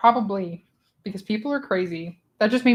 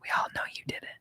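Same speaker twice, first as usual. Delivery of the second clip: conspiratorial whisper; secretive whispered accusation; 11 dB quieter, much faster than usual. Pretend.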